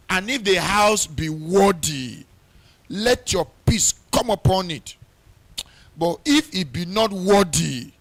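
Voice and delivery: loud, ringing pulpit preaching; fervent wedding sermon preaching. Acted. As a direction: preach it out with full force. Preaching at full force, reading scripture.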